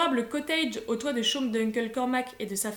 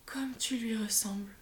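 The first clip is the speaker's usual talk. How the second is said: softer, toneless murmur; murmured whisper; 2 semitones lower, 8 dB quieter than usual. A narrator's reading. Grandmother murmuring to her granddaughter, lost in memory, whisper. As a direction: whisper it as if far away. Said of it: "murmure-t-elle".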